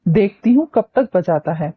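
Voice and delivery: ordinary delivery, nothing marked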